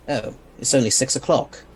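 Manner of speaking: ordinary talk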